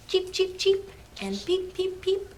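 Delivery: high little cheeps; chirping birds. Acted. Reader voices little birds.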